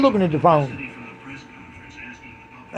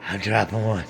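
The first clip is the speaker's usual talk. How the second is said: much lower and softer, muttered aside; mock hushed voice; 8 semitones lower, 6 dB quieter than usual. Comic voicing a smug gas-passer, low and soft. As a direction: drop it low like a sly confession. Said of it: "Tell you what".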